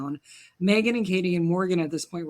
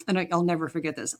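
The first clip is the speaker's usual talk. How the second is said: ordinary talk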